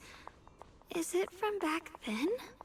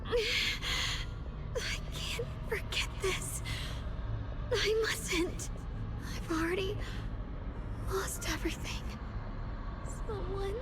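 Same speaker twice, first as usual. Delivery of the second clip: higher, breaking cries for help; desperate cry; 6 semitones higher, slower than usual. Acted. Character losing her senses calls for her brother, crying out. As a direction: plead into the dark, voice cracking.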